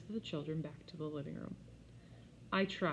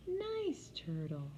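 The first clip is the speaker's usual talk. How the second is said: put-on slow little boy; 8 semitones higher, much slower than usual. A narrator's reading.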